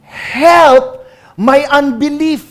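loud, high-pitched desperate plea; acting out a father's anguished cry. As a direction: cry it out loud, slowly, at the top of the range. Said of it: "na umiiyak, sabi niya".

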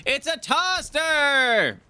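drawn-out, high-pitched holler; hyped announcement. Pretend. Streamer announcing a toaster to the chat, hyped-up holler. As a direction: cry it out slowly like a big reveal.

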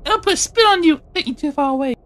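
high squeaky pretend monster taunting; squeaky creature voice. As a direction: play a silly high-voiced little monster. Kids playing, squeaky creature voice.

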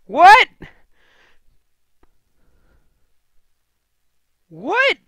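high loud shocked what; shocked shout. Real, not acted. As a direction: scream it in disbelief. Shock at poem ending.

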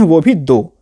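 usual speaking voice

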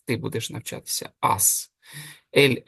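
normal talking voice

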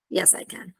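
"Yes, I can." very soft and low; teacher's hushed model reply on "Yes, I can."; umbrella intonation answer. Shown as a demonstration. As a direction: drop "Yes, I can." almost away. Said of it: "fa formare sempre la mia forma dell'ombrello".

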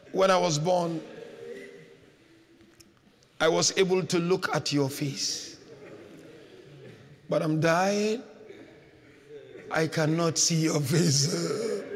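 low, subdued, with long pauses; dying words. Acted. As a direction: let it sink like a dying man's farewell. Dying man's last words, retold.

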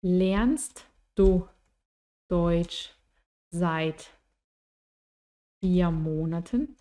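slow, separated words in "Lernst du Deutsch seit vier Monaten". Shown as a demonstration. Teacher building a question for learners, word by word.

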